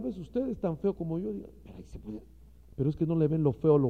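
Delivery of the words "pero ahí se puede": breathy whispered "ahí se puede"; a sly whisper; whispered aside. Acted. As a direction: whisper it like a secret. Imagined woman's aside.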